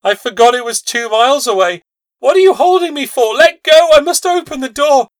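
high, loud, frantic begging; frantic pleading. Acted. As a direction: beg wildly to be let go. Mother fights to reach door.

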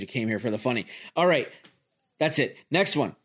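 usual speaking voice

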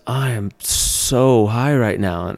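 slow, drifting, spaced-out voice. Retold or imitated. Wife leaning in, confessing she's stoned, slow and dreamy. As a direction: let the words float out stoned and slow.

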